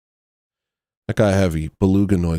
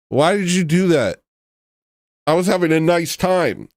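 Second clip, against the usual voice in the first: higher, wounded complaint; higher mock-hurt protest at the chat; mock indignant; 7 semitones higher than usual. Real, not acted. Upset at gory whale image.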